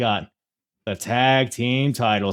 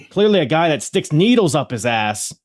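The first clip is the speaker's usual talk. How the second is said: ordinary delivery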